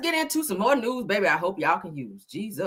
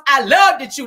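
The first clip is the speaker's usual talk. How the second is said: higher, louder, punchy imitated Wanda on loved; 5 semitones higher, 8 dB louder than usual. Shown as a demonstration. Host redoing the line to Gail in a higher Wanda voice. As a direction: belt it out the way Wanda would. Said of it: "In my Wanda voice".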